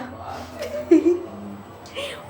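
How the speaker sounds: quick airy laugh, pitched high; giggling at the husband's joke; breathy laugh. Real, not acted. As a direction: let out a light breathless laugh.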